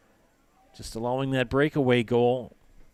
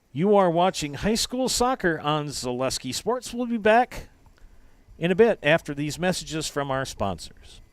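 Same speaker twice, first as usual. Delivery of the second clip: announcer; 5 semitones higher than usual. Real, not acted. Station break announcement.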